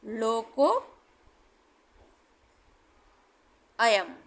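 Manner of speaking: लोको and अयम said apart with a long pause; slow demo word. Shown as a demonstration. Teacher slowly sounding out लोको अयम. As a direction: split लोको from अयम clearly. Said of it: "जैसे".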